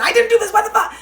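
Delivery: high, loud mock protest; hammed-up defensive yell; defensive outburst. Imitating a defensive driver after a crash. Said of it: "on the defense".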